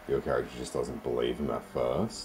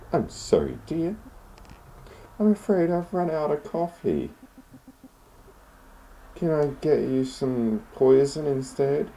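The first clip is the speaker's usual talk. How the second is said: very high granny voice, slowing on the last line; sinister sweet old lady; 14 semitones higher, 3 dB louder than usual. Acted. Landlady offering poison instead of coffee, high and sweet.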